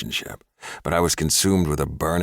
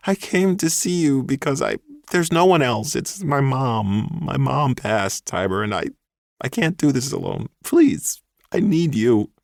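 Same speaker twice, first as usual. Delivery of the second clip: tearful plea; 9 semitones higher, 3 dB louder than usual. A narrator's reading. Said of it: "eyes filled with tears".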